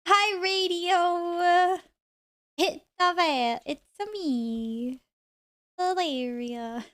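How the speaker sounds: hammy slow put-on voice; silly put-on voice. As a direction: drag it out in a goofy character voice. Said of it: "that was just really bad".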